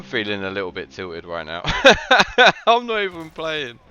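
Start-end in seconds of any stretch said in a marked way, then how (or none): 1.6-3.8 high, loud words riding on a laugh; amused laughter. Real, not acted. Spectator admitting feeling tilted, laughing. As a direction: laugh at your own frustration.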